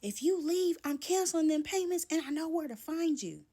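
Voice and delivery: high, loud yelled threat; screamed threat. Retold or imitated. Boyfriend screaming as she walks out the door, loud threat. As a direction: yell it after her as she leaves. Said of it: "he screams".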